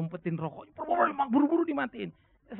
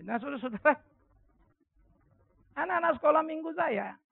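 usual speaking voice

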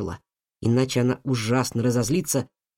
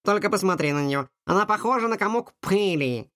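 dismayed cat; 8 semitones higher than usual. A narrator's reading. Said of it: "Кадабра была готова разрыдаться".

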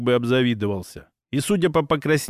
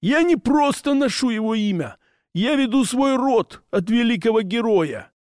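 high, boastful orc hunter; proud orc voice; 8 semitones higher than usual. Acted. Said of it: "гордо выпятил грудь".

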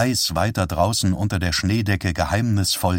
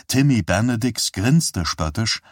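ordinary delivery, nothing marked

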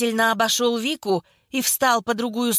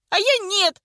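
high-pitched heated retort; defiant cry; 10 semitones higher, 3 dB louder than usual. A narrator's reading. Vika rejecting Nonna's faith in her, defiant cry. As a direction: fling it back, high and vehement. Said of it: "крикнула Вика".

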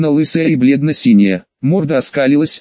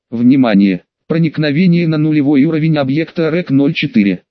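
plain reading voice

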